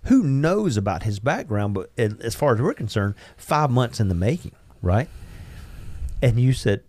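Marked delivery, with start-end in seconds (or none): none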